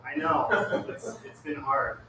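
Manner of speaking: normal talking voice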